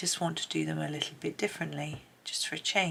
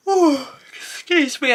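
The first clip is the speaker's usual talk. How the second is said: sudden high, loud oh, then a quick excuse me; startled excuse me; 10 semitones higher, 13 dB louder, slower than usual. Real, not acted. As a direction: caught off guard, blurting out an apology. Caught off guard mid-sentence.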